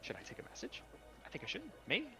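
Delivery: rapid, high, flustered sprite; babbling tiny fairy; fast chattering fairy. Acted. GM voices fairy.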